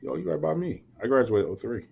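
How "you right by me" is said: ordinary delivery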